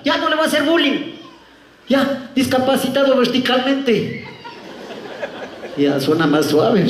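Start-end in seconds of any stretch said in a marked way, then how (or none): 0.1-4.3 high, slowed sarcastic giving-in; concede, then stretch out the insult; high-pitched taunting landlady; mock concession.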